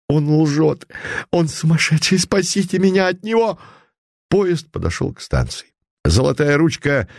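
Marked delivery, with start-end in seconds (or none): 0.1-3.6 hysterical cry. A narrator's reading. Swindler cries out to passengers.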